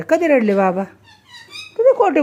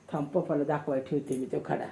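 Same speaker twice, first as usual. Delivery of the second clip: much lower and softer, a quiet playful threat; hushed mock threat; 9 semitones lower, 14 dB quieter than usual. Mother tells the girl she will show Papa, hushed. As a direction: murmur it as a pretend warning.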